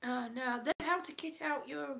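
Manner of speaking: normal talking voice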